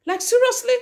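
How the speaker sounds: high-pitched, incredulous protest. Real, not acted. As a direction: question it in sheer disbelief, voice shooting up. Disbelief that parents won't apologise.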